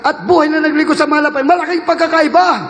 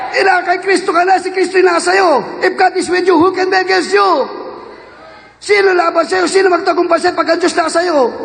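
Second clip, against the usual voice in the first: raised, urgent preacher's call; rally the faithful with a higher, pressing voice; fervent preaching; 4 semitones higher than usual. Real, not acted. Preacher urging a sister that Christ is with her, fervently. Exhorting congregation, Christ within.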